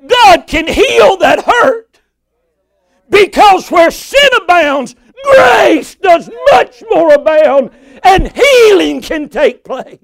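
high, loud preacher's shout; full-force preaching. Real, not acted. Preacher promising God heals hurt, full-force preaching. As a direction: cry it out with long, drawn-out key words.

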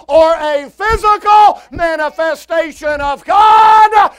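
high, shouted pulpit proclamation; full-force preaching. Real, not acted. Preacher declaring Jesus the manifestation of God, full-force preaching. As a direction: shout it out with long-held key words.

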